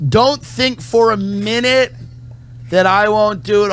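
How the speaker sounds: hammed-up defiant vow; mock threat. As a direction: dare them to doubt you.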